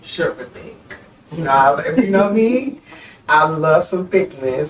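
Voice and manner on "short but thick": whispered, a little coy. Real, not acted. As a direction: breathe the answer, no voice. Answering a racy question.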